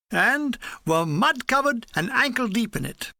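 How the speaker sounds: ordinary delivery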